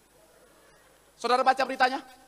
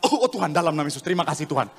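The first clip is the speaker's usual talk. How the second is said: mock fervent prayer; 6 dB louder than usual. Preacher mimics a believer praying as coughing starts, mock fervent prayer. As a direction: pray with exaggerated fervour, swelling to a big cry.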